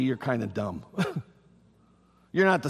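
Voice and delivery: ordinary talk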